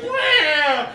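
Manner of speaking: high, loud startled meow; startled cat meow. Retold or imitated. Comic voicing the startled cat, loud high meow. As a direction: yowl like a spooked cat. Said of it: "he goes".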